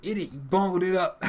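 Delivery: loud mock scolding; berating. Game character berates player for a botched move, loud scolding.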